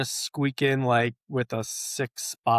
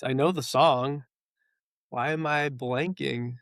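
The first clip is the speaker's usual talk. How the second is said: usual speaking voice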